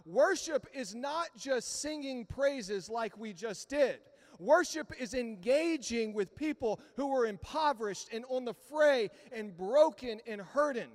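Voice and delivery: a pastor building to a loud, impassioned climax; full-force preaching. Acted. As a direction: drive it home to the congregation.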